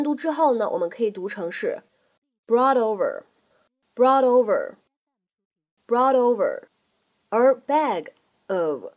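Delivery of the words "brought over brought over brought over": brought over with the T flapped, said slowly. Shown as a demonstration. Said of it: "闪音的处理".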